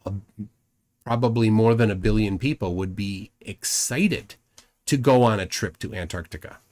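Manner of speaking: ordinary talk, nothing marked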